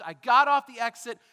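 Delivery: usual speaking voice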